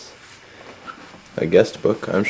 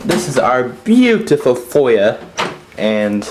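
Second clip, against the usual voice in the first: slow, louder, showy tour-guide voice; TV host accent; 3 semitones higher, 5 dB louder, slower than usual. Retold or imitated. Host presenting the foyer, slow louder celebrity-host accent. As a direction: show off the foyer like a lifestyle host. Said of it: "I'll cut the accent".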